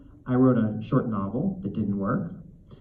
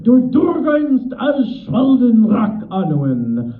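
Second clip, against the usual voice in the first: loud, hoarse, slow Dwarvish tirade with rolled R's; harsh dwarvish growl, drawn out and raised; angry dwarf; 6 semitones higher, 7 dB louder, slower than usual. Acted. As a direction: bellow like a complaining dwarf, wiggling the uvula. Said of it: "one of the dwarves being all angry and complaining".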